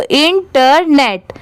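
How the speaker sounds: "Internet" slowly in three parts, in, ter, net; syllable by syllable. Shown as a demonstration. Teacher joining up the parts for learners, "internet" slowly by syllables. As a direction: break "internet" into careful separate pieces. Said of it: "अब इसे साथ में मिलाएंगे".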